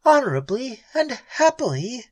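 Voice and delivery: high, incredulous bitter echo; bitter echo of the hero's words. Acted. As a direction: throw his words back at him, bitter. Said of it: "she echoed bitterly".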